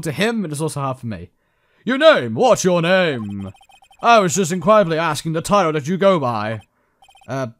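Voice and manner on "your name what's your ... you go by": loud, theatrical, pitched-up demand; hammed-up CEO character. CEO questioning a visiting lawyer, hammed-up pompous voice. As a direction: boom it out with pompous showmanship. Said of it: "I need to give him a voice".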